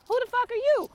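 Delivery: high, loud confrontational outburst; indignant challenge. Real, not acted. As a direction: fire it at the listener in outrage. Indignant challenge to strict parents.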